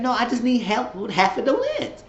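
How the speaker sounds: retold plea. Danny asking Zach for rent money, high-pitched mock pleading. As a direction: act out an insistent high plea.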